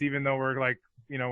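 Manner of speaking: ordinary delivery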